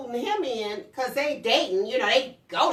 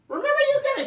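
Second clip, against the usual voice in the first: much higher, told-you-so; teasing reminder; 6 dB louder, faster than usual. Retold or imitated. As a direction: tease the grown daughter. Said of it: "I said".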